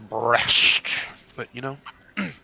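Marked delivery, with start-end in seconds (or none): 0.1-0.9 Breast shouted out loud and punchy; loud emphatic repeat. Real, not acted. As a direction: bark the word Breast for emphasis. Repeats the word much louder.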